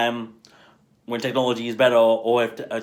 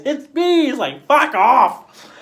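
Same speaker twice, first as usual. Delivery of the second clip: much higher and louder, slowing on the end; mock reveal; 14 semitones higher, 8 dB louder, slower than usual. Andy Serkis unmasking in a remake, loud high mock reveal. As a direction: shout the big reveal then snap.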